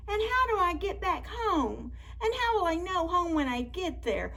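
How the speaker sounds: high-pitched small storybook voice; wondering little sweet potato voice; little lost character. Acted. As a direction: ask it high, like a tiny lost creature.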